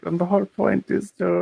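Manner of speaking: high-pitched mock movie-character voice; imitated Strangelove delivery. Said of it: "The delivery on that line is perfect".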